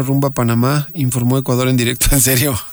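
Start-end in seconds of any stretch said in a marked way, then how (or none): 2.1-2.7 laughing in disbelief. Real, not acted. Host laughing at a listener's message. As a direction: bursts out laughing at the news.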